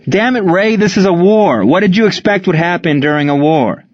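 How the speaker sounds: angry, high-pitched rebuke; exasperated outburst. Acted. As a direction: snap at a player in exasperation. Coach angrily rebukes player.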